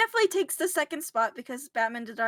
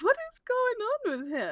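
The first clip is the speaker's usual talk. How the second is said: incredulous; 11 semitones higher than usual. Retold or imitated. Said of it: "So I was like".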